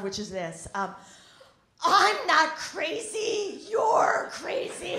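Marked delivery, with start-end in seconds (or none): 1.8-5.0 slow, higher mock scream of a mother; hammed-up shriek of an exasperated mom. Comic imitating her mother screaming at her. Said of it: "she screams out the phrase".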